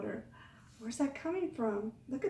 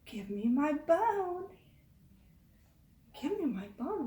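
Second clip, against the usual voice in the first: slow, eerie ghostly voice; spooky voice; 3 semitones higher than usual.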